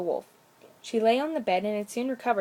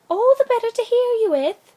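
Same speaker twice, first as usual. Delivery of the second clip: very high, loud sly granny voice; wolf hiding behind a squeaky old-lady voice; wolf as grandmamma; 13 semitones higher, 11 dB louder than usual. A narrator's reading. Wolf in bed answering the girl, high and loud.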